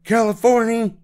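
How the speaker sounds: high, loud echoing holler; shouted cheer. A narrator's reading. Passengers shouting the news after the driver, shouted cheer. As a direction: shout it like a whole coach cheering. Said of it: "shouted everyone except for baby Betsy".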